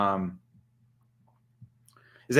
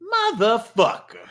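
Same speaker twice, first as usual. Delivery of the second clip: Samuel L. Jackson; 10 semitones higher, 3 dB louder than usual. Acted. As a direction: savour the swear like an action star. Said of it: "Do a Samuel L. Jackson".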